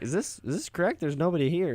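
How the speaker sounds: high, hesitant questioning voice; confused moviegoer. Retold or imitated. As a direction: imitate a puzzled person walking in. Voices confused cinema-goer walking in.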